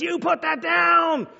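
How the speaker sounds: high, slow imitated mom shout; wife yelling. Mom catching kid with permanent marker, imitated shout. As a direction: shout the order like a mother. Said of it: "she starts yelling".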